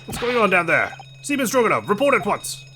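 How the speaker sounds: calling out. Acted. Officer calls down.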